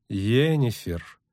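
slow, drawn-out "Йеннифер"; measured, slow call to order on "Йеннифер"; reproving. Acted. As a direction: stretch "Йеннифер" as a restrained warning. Elf reins in her defiance.

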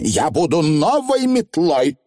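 high, boastful witch; smugly confident young witch; self-important young witch. Acted. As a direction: announce it grandly, drawn out and high. Voices Anagramma, boastful.

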